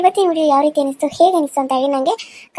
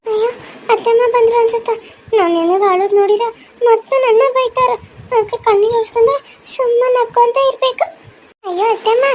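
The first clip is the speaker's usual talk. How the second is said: put-on high child voice, tearful aside; anxious little girl; 8 semitones higher, 3 dB louder than usual. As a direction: worry like a child afraid of a scolding. (ಅತ್ತೆಗೆ ಹೆದರಿದ ಮಗುವಿನ ಎಳೆಯ ಧ್ವನಿ)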